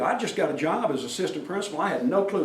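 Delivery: ordinary talk, nothing marked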